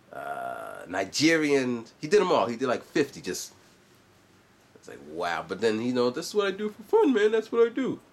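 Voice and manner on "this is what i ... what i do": much higher, put-on cocky voice; high mock bragging dude; imitating a YouTuber. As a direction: show off like a guy proud of his hobby.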